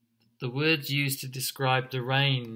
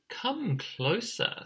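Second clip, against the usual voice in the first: slow, higher, singsong invitation; luring fox; 9 semitones higher, slower than usual. Acted. Fox lures the emu chick closer, slow coaxing voice. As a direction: coax like a sly fox, drawing it out. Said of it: "said Fox".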